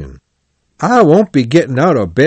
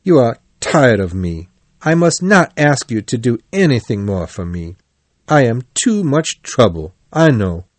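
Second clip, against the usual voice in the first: ordinary delivery, nothing marked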